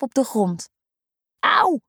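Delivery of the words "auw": sharp, high yelp of pain; a quick ouch as he hits the ground; boy falling from the climbing frame, high yelp; cry of pain. Acted. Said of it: "roept Tim uit".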